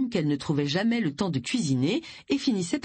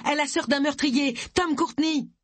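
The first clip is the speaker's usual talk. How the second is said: breathless, higher, gasped exclamation; breathless accusation, pitched up; panting; 9 semitones higher than usual. A narrator's reading. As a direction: gasp it out while out of breath. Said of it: "haleta Agatha".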